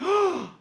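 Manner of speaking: sharp, loud, high gasp; mother's gasp. Retold or imitated. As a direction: gasp like a mother hearing a terrible sentence. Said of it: "my mother gasped".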